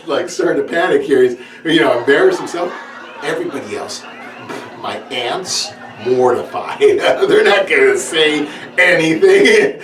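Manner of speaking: ordinary talk, nothing marked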